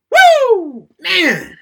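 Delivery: high, loud joyful whoop; cheering whoop. Retold or imitated. A boy cheers his wrestler's win in front of the TV, whooping. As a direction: whoop like your hero just won.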